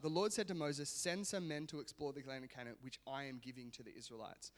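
soft, even Bible reading voice; scripture reading. Acted. Pastor reading Numbers to the church, soft reading. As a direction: read the passage quietly to the congregation. Reads scripture passage aloud.

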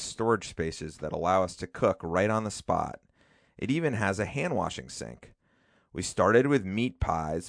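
letter read aloud. Acted. Reads his letter to grandmother aloud.

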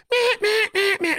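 very high, short quacking hen calls; imitated hen quacks. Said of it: "he almost stands up on his tippy toes like a little ballerina and goes".

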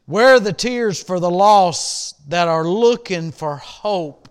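loud, slow preaching swell; slow, loud pulpit cry, building to hope; preaching at full force. Acted. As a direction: lean into the question like a revival preacher. Preacher raises voice on refrain.

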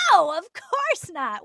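high, loud, drawn-out no; emphatic denial. Retold or imitated. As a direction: re-enact an exaggerated, oblivious denial. Own reply to agents acted out.

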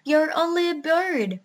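high, loud, whiny dismissal; mildly tearful storybook whine; tearful scorn. A narrator's reading. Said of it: "turning up his nose and beginning to cry again".

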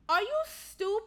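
high, slow incredulous question; outraged disbelief. Retold or imitated. Viewer berating the girl who moved back in, incredulous. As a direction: stretch it out, pitched high in disbelief.

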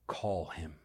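low, soft summons; unhurried, hushed instruction; quiet command. Acted. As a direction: say it softly and low, with calm authority. Jesus's words read in scripture.

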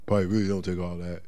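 low laid-back mocking voice; lazy brushing-off voice. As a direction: shrug it all off like a backslider. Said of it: "you be like".

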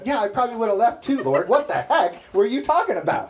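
put-on high, fast, laughing girlish quote; imitating Clarissa. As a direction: tease as a friend would. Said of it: "She said".

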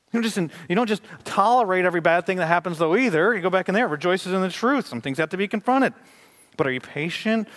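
preaching with rising urgency; urgent preaching. Acted. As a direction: press the point hard.

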